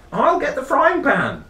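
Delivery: high, loud yum; gleeful. Acted. Rory hearing the postman is due, gleeful cry. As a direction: cheer at the thought of food.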